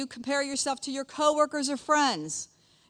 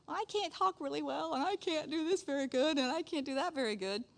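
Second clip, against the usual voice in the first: higher, softer mock whine; whiny excuses; 6 semitones higher, 4 dB quieter than usual. Moses excusing himself to the burning bush, whiny. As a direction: plead incapable in a small high voice.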